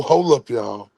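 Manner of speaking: ordinary talk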